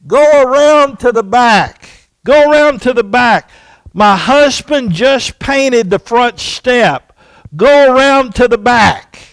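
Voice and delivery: loud, high call across the yard; yelling at a child. Retold or imitated. Neighbor lady calling to little Johnny outside, loud. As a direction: shout the order out the window. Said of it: "she began to yell at him and said".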